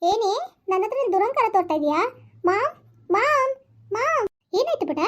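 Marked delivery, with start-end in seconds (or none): 2.4-3.5 put-on high, slow, drawn-out childish call; high drawn-out tattling mom call; whining call for mom. Calling mother for help. 3.9-5.0 a lightly voiced mother, a bit higher; lightly voiced mom checking on her child.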